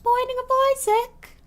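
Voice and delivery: silly high announcer intro. Pretend. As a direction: boom it out like a game-show host. Repeats game title in silly voice.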